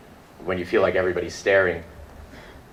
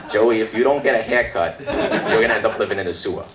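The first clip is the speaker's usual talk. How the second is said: raised-pitch mock mother warning her son; scolding mom; 4 semitones higher than usual. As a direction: nag like somebody's mom. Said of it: "that's what their mom said would happen".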